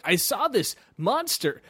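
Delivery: put-on slow and wide-eyed like a kid. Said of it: "the kid would call you and be like".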